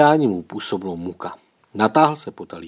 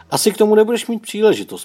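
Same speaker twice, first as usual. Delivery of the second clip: high, quick, louder remark; curt, measured; 11 semitones higher, 6 dB louder, faster than usual. A narrator's reading. Tomáš answers his ranting brother about Otík, curt. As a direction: cut him off coolly at a high pitch. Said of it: "poznamenal odměřeně Tomáš".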